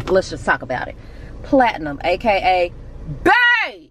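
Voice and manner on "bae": "Bae" pitched way up and stretched; stress on BAE. Shown as a demonstration. Vlogger flagging the nickname, heavy high emphasis on "Bae". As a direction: hit "Bae" hard with a high swoop. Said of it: "emphasis on Bae".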